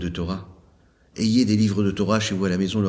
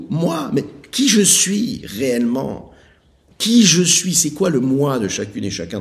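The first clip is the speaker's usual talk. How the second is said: fervent preaching; 9 semitones higher, 8 dB louder than usual. Acted. Rabbi preaches on the three words, fervent. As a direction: preach it with full force.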